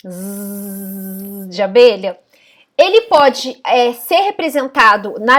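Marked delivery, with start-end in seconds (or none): 0.1-2.2 "Z" drawn out slowly like a bee's buzz. Shown as a demonstration. Teacher buzzing the Z sound for the class. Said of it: "o fonema Z".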